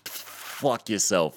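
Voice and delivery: long sputtering "f-" before a drawn-out "fuck yourself"; deliberately held F. Acted. Telling homeless people off, deliberately held F. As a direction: hang on the F of "fuck", then burst out. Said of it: "I meant to do the f".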